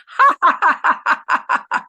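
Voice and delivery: loud, high forced laughter; hello laughter. Shown as a demonstration. Instructor leading the group in hello laughter, high loud laughing. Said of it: "Come on, everybody, do it with me".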